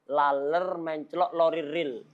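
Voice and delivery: careful, separated tongue-twister words. Shown as a demonstration. Teacher introducing a tongue twister to students, word by word. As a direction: lay out the twister piece by piece. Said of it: "Tapi kalau antum merangkai".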